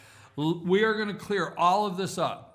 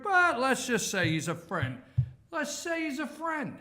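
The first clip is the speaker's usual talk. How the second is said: higher-pitched, glib boss letting someone go; mock firing boss; 6 semitones higher than usual. Boss sends Lewandowski out with a "friend", mock firing patter. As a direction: play the boss easing an employee out with excuses.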